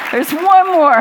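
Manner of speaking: high, loud words through real laughter; laughing. Real, not acted. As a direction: call it out while cracking up. Laughing along with the audience.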